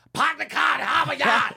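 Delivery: loud, high-pitched hammed-up Boston accent; broad shouted Boston twang. Shown as a demonstration. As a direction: yell it like an over-the-top Bostonian.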